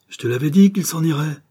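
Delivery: a wife bursting out in reproach; exclaimed. A narrator's reading. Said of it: "s'écria-t-elle".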